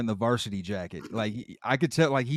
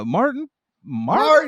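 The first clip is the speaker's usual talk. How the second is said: 1 puzzled recall; 11 semitones higher, 6 dB louder than usual. Retold or imitated. Varnell trying to recall him.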